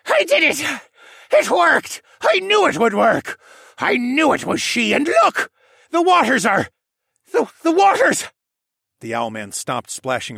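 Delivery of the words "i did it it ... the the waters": high, loud feverish villain glee; manic elation. Acted. Villain splashes in the healing waters, manically elated. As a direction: celebrate wildly, then start to falter. Villain celebrates, then falters.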